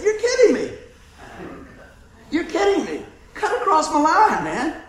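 can't believe it, half-laughing; incredulous. Retold or imitated. Angler laughs in disbelief at stranger crossing his line.

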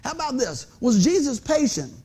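ordinary talk, nothing marked

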